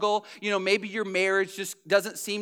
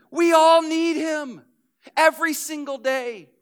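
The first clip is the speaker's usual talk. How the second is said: preaching build; 7 semitones higher, 6 dB louder than usual. Acted.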